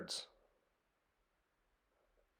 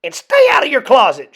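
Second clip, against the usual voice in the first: louder, higher, fast order; sharp parting order; 8 semitones higher, 12 dB louder, faster than usual. A narrator's reading. Mother closing the door on her son, sharp order. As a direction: snap it as the door closes. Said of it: "she added".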